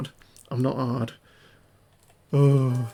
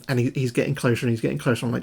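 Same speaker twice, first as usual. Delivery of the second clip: ordinary delivery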